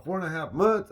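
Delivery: high, loud disbelieving question; surprised opponent. Retold or imitated. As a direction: act stunned at the number. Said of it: "He's like".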